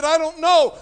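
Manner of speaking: cried out, high and loud; desperate reply. Retold or imitated. [name] answering his little brother, desperate cry. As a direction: answer in helpless panic. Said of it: "I said".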